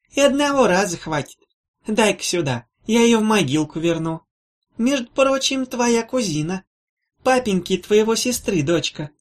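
put-on mumbling old woman. A narrator's reading. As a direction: mumble it like a fussy old granny. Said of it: "прошамкал рядом заботливый голосок".

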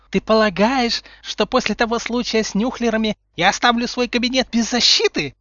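high, loud indignant voice; indignant scolding. A narrator's reading. Umbridge catching Potter in her office, indignant. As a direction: scold a caught intruder.

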